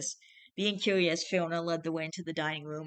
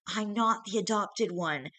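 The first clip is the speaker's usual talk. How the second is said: ordinary delivery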